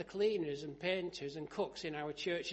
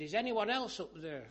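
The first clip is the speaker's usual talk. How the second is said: raised, higher comic cry for help; retold shout; 4 semitones higher, 7 dB louder than usual. Dangling man seeking another rescuer, shouting. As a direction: shout up past the first answer. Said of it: "And he shouted".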